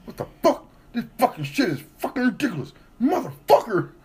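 high mock rant of a muffled stranger; hammed-up high angry cursing; imitated angry rant. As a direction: rant like the man behind the mask. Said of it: "all you hear is".

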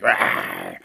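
deep heavy lifting grunt; mock weightlifter grunt. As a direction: grunt as if hoisting something heavy. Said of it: "they're like".

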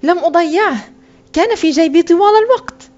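put-on high, insistent boy's voice; child's protest. A narrator's reading. Boy searches for his lost coin, protesting.

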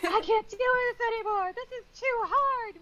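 high, loud, overwrought wail of giving up; mock despairing outburst. Teammate Christoph at the breaking point, mock wail. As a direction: ham up a stressed teammate who can't go on. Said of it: "sometimes it's Christoph, not crying, but swearing".

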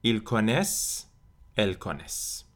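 "connaissent" ending on a clear S, -ent silent. Shown as a demonstration. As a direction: stop "connaissent" on the S sound. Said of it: "انتهای آخر تلفظ نمیشه".

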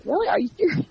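high-pitched, incredulous question; retold disbelief. Host retells being offered the show, incredulous. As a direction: re-enact pleased disbelief.

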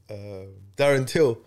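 usual speaking voice

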